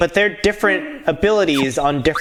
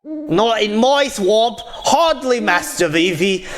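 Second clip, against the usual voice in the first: shaky-attempt Scottish ogre accent, higher-pitched; Shrek; 4 semitones higher than usual. Streamer voices a knight praising Master Vivi, mock Scottish accent. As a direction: put on a hammy Scottish accent. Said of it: "Scottish accent".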